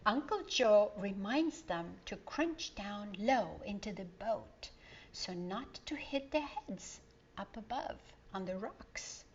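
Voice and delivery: usual speaking voice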